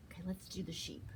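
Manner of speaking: ordinary talk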